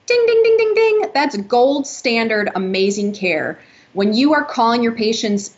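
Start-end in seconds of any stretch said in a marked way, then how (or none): 0.1-1.1 high sung ding ding like a jackpot bell. Shown as a demonstration. Host hails doctors calling patients, ringing jackpot bell sound. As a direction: ring it out like a winning bell. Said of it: "that is like".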